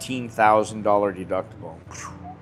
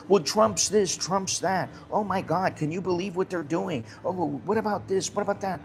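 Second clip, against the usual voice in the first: much higher, mocking gasping gossip about Trump; 8 semitones higher than usual. Mocking expats who gossip about Trump, in a much higher gasping voice. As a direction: parody the breathless outrage.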